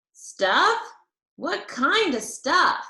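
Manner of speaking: eager to hear the secret; boy leaning in for a secret; Herb curious. Acted. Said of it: "he asked".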